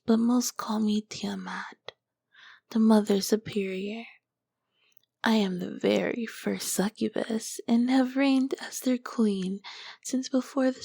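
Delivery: seductive ancient demoness, whispered close to the ear; a sultry succubus whispering to her summoner; whispering succubus queen. Acted. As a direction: tease the mortal in a hushed, knowing whisper. Whispered succubus roleplay throughout.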